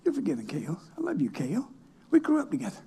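half-whispered, tender; murmured blessing. Retold or imitated. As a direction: breathe it to him like a secret prayer. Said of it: "did I say subliminal to this man".

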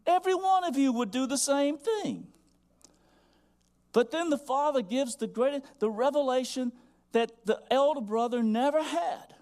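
high, loud pulpit cry; soaring sermon climax; full-force preaching. Acted. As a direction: proclaim the father's gift from the top of the voice. Preaching climax, father's promise.

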